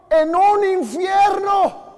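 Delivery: slow loud shouted emphasis. Real, not acted. Preacher warning congregation, slow shout on infierno. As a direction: drag it out and shout it.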